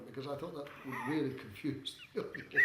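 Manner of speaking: ordinary talk, nothing marked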